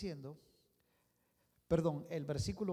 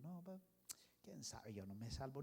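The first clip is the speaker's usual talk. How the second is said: hopeless mumble; 3 semitones lower, 11 dB quieter than usual. Acted. Humans doubting salvation, mocked.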